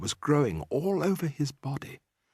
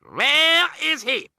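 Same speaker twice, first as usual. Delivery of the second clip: much higher, louder, slow witch snap; snapping; 5 dB louder, much slower than usual. A narrator's reading. Grand High Witch searching for the mouse, snapping. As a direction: snap the question. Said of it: "snapped the Grand High Witch".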